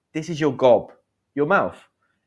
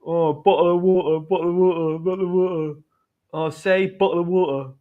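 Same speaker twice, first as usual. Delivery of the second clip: put-on higher-pitched London accent with dropped T's; mocking London accent; 6 semitones higher than usual. People teasing British speakers, mock London accent. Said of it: "They don't cut the T's".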